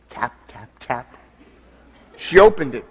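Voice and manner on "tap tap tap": very soft, low taps spoken as words; soft door tapping. Acted. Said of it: "tapped on her door".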